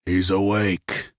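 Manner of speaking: ordinary delivery, nothing marked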